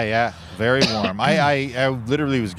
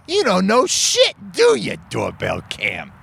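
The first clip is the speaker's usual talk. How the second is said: mocking; 8 semitones higher than usual. Mocking the doorbell camera.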